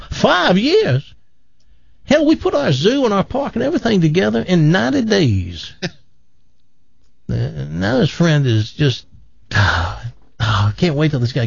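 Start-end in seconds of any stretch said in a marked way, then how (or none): 0.1-5.7 high, scoffing boastful Texan; bragging Texan. Acted. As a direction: scoff and brag like a big-talking Texan. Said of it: "Texas buddy".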